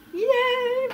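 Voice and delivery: high, drawn-out little cheer; celebratory cheer. Real, not acted. Knitter celebrates her first handspun sweater with viewers, cheering. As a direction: cheer yourself on, high-pitched.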